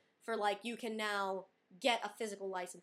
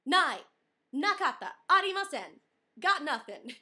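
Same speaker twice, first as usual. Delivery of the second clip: loud flustered denial; 6 semitones higher, 9 dB louder than usual. Retold or imitated. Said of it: "And I'm like".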